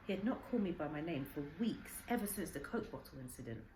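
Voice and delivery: plain reading voice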